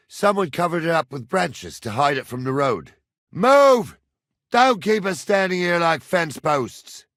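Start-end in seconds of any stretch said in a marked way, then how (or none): 3.3-7.0 loud, high impatient shout; shouted order. Acted. Man kept standing like fence posts, shouting impatiently. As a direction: holler to get them moving.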